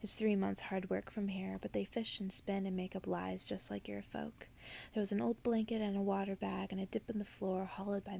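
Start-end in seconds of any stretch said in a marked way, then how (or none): none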